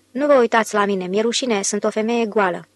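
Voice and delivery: ordinary delivery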